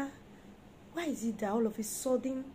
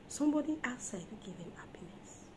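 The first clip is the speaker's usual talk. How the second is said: soft unvoiced warning; whisper; 8 dB quieter than usual. Real, not acted. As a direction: let it drop to a whisper. Warning wives, hushed.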